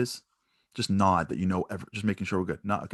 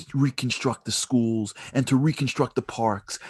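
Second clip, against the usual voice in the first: ordinary delivery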